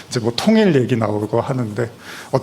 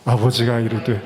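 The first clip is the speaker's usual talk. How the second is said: ordinary delivery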